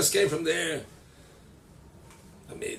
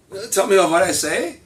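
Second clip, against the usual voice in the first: louder, strained plea to repeat the lesson; pleading teacher; 4 semitones higher, 9 dB louder than usual. Retold or imitated. Teacher begging the weak kid, strained cry. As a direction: beg the kid to say it back.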